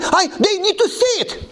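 high-pitched, insistent pleading, imitating an overexcited convert; acted-out desperate plea, voice pushed way up; exploding with urgency. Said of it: "I feel I am exploding".

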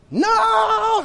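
long, high-pitched mock scream. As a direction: wail it out as you're dragged away. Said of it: "he's screaming".

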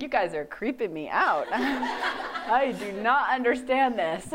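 laughing through it, slowing; laughing at the pious classmates, slowly; laughing disbelief. Retold or imitated. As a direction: giggle at how creepy it seems. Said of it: "And I was like".

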